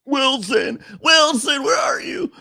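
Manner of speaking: high desperate shout for a lost friend; Tom Hanks calling Wilson. Retold or imitated. Tom Hanks alone on the island calls for Wilson, high shout. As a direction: call out for Wilson, stranded and frantic. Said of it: "Tom Hanks and ... Looking for Wilson".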